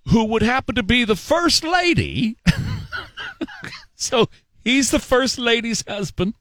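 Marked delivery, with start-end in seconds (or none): 2.4-3.7 real high-pitched burst of laughter. Real, not acted. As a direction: crack up, can't hold it in. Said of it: "I'm laughing".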